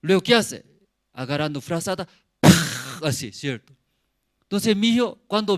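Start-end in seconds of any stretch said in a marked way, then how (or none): 2.4-3.0 loud, sudden burst of noise; imitated blanket whoosh. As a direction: mimic a blanket being yanked away.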